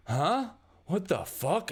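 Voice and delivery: startled, high-pitched outburst. Acted. Man catching intruders in a restricted hallway, startled. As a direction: blurt it out in shocked disbelief.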